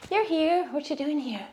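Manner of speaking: high, soft voice for the cat; pet talk. Real, not acted. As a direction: coo at the cat. Talking to her cat Tinky.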